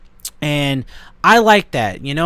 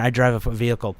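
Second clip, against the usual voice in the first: ordinary delivery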